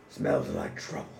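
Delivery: soft, unvoiced menacing monster rasp. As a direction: breathe it out with quiet menace. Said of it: "and he says".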